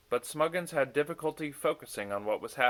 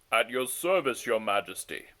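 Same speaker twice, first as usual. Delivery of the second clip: put-on lower, rougher retake of the old messenger; deep and gravelly; 4 dB louder than usual. A narrator's reading. Onegin steps forward to serve the king, deep and gravelly. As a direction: settle down into a gravelly bow. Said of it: "his voice deep and gravelly".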